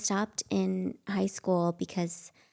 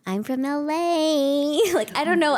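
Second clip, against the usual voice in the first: put-on LA girl voice; 7 semitones higher, 6 dB louder than usual. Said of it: "that sound like me".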